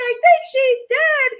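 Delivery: high, loud, slow wail of alarm; panicked kids. Retold or imitated. Frightened students telling the teacher, high and loud. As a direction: cry it out like a scared child.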